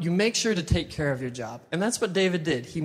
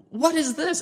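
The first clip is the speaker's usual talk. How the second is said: astonished David; 10 semitones higher than usual. Acted. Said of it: "David's like".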